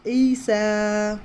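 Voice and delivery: ईसा with a long drawn ई, held for two counts; stretched vowel. Shown as a demonstration. Teacher showing students the two-count ई in ईसा. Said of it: "यहाँ भी दो हरकत खींचना है".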